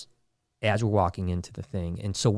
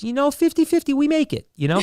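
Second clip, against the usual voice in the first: high, carefree put-on voice; breezy fixer voice; 4 dB louder than usual. Fixer shrugs off the danger to Jonah, high and breezy. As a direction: toss it off high and casual. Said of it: "and he goes".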